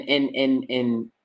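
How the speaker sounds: usual speaking voice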